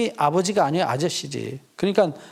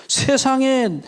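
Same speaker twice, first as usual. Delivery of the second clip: ordinary delivery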